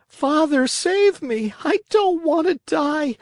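very high, loud desperate plea; crying pitifully. Acted. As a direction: scream for your father, terrified. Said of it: "crying pitifully".

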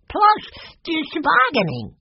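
put-on very high, excited kid voice; little boy piping in. A narrator's reading. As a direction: squeak it out like an eager boy. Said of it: "Benny managed to say".

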